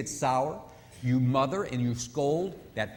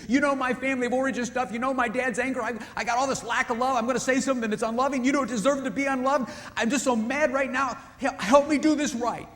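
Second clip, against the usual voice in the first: higher, louder, upset husband pleading for help; 10 semitones higher, 7 dB louder than usual. Retold or imitated. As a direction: beg her to help him get it right. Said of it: "Just as he says".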